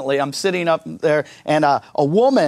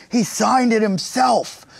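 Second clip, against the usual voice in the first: mock awe; 7 semitones higher, slower than usual. Mocking impressed letter recipients.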